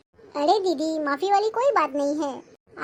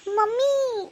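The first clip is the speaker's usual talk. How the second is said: higher, anxious call for mum; 4 semitones higher, much slower than usual. Acted. Daughter rushes home calling her mother, worried.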